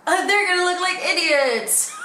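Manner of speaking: high, loud laugh through words. Real, not acted. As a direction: laugh it out gleefully. Laughing at the idea.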